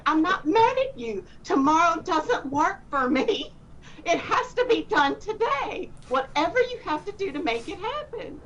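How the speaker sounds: a put-on exasperated demand, pitched high; exasperated insistence. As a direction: insist he get it done today, exasperated. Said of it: "this wasn't exactly the tone that I was speaking in".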